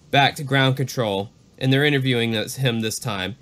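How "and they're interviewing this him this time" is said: ordinary talk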